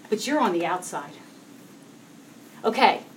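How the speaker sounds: ordinary talk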